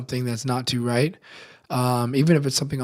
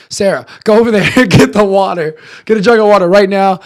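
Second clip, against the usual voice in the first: loud, higher-pitched retold command; shouted orders; 6 semitones higher, 11 dB louder than usual. Teller orders Sarah to fetch water, shouted urgently. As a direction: fire off an urgent order, raised voice.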